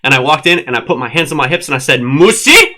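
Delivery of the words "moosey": hammed-up Ricky Ricardo accent, much higher and louder. Scolding the dog with hands on hips, mock Ricky Ricardo accent. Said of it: "don't give me the accent".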